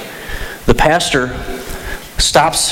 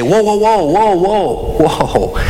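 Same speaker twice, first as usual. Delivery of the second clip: put-on disciples halting the kids, higher at first; alarmed objection; 4 semitones higher, slower than usual. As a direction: wave them off with rapid whoas. Disciples stop children approaching Jesus.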